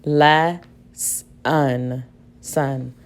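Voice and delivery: "Lesson" broken into "le... s... un"; "son" said as "sun", slowly separated; sounding out with schwa. Shown as a demonstration. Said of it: "Let me try the schwa sound".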